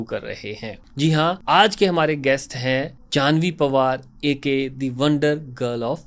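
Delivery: soft, even promo narration; podcast voiceover intro. Acted.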